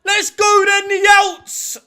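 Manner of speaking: high, very loud fan cheer; rallying shout. Real, not acted. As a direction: yell the team on at kickoff. Cheering team at kickoff.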